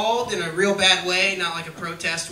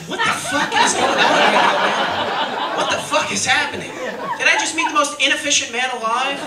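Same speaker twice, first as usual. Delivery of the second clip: loud, higher, laughing disbelief; exasperated outburst; 6 semitones higher, 4 dB louder than usual. Retold or imitated. Comic retelling his exasperation at Steve's name, loud and laughing. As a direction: blow up at the absurd name. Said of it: "I was like".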